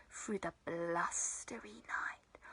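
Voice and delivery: low unvoiced whisper. A narrator's reading. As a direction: hush it into the night. Whispered storytelling.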